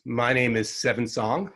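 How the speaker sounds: normal talking voice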